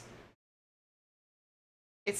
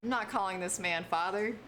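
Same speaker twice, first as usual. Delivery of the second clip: ordinary talk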